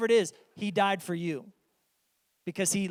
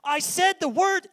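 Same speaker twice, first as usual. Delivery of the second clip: whiny protest; 13 semitones higher, 10 dB louder than usual. Retold or imitated. Kid arguing with parent.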